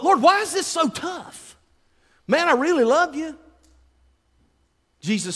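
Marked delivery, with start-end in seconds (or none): 0.0-3.4 high, strained plea to the Lord; pleading prayer. Retold or imitated. Preacher complaining to the Lord, high pleading. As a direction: cry out in frustration.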